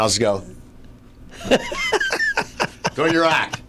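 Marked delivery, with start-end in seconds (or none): 1.4-2.9 high squeaky puppet laugh; squeaky marionette noise. Pretend. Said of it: "My marionette impression".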